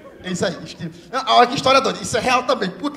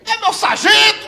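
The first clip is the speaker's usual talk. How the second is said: mock soldier report; 9 semitones higher, 5 dB louder, slower than usual. Friend plays along.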